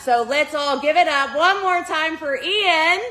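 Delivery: loud, high rally call to the crowd; cheering call. Real, not acted. Host calls for applause again.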